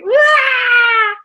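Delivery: high, loud cartoon crying wail; mock wail. Friend falling on the sidewalk, mock crying wail. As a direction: wail like a fallen child. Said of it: "And she's like".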